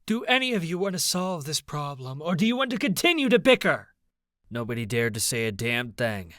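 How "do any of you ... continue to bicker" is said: high, angry question getting louder and faster; bellowed reprimand. A narrator's reading. Queen Emmeline rebukes the bickering council, bellowing. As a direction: scold the room, building to a shout. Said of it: "she bellowed".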